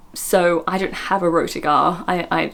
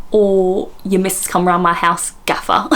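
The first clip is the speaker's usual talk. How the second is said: mock Cockney banter. Host playing a Cockney talking to the gaffer, mock Cockney. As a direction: put on a Cockney accent, dropping the H. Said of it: "always think of with Cockney".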